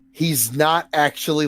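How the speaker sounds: usual speaking voice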